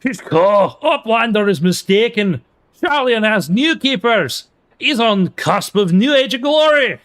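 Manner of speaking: put-on high, loud terrible Scottish accent. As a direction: voice the NPC in a bad Scottish accent. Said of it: "give the first N P C that I see without a voice a terrible Scottish accent".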